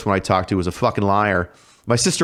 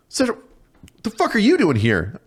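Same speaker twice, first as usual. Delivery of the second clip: surprised; 11 semitones higher than usual. Retold or imitated. Recruiter shows up at the door.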